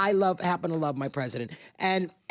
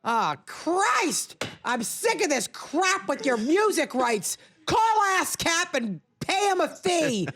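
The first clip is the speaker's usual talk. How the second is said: higher, slow, hammed-up exasperated rant; a high slow mock tantrum about paying for music; mock angry rant; 10 semitones higher, slower than usual. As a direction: blow up in fake outrage over fees. Mock tirade about music rights.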